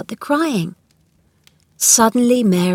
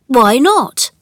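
louder, higher, mildly startled question; surprised; 6 semitones higher, 7 dB louder than usual. A narrator's reading. Colin questions Mary's outburst, mildly surprised. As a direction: ask with a touch of surprise.

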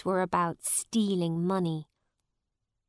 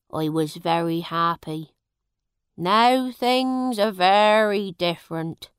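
sad; 7 dB louder than usual. A narrator's reading. Farmer tells his sad story.

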